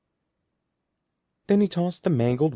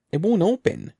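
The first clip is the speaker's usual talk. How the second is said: higher, straining call; frustrated higher outcry; strained cry; 8 semitones higher, 3 dB louder than usual. A narrator's reading. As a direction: cry out while tugging hard. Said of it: "he cried".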